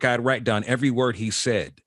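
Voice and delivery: ordinary talk, nothing marked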